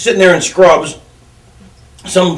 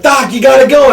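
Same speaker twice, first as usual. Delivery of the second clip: urgent mock panic; 6 semitones higher than usual. Panicking boss urging doctor.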